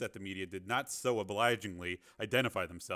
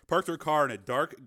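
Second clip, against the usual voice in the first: ordinary delivery, nothing marked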